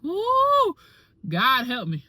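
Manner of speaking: high, loud whoop then a plea; excited whoop. Retold or imitated. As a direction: cheer up high to stay awake. Cheering while pleading for strength.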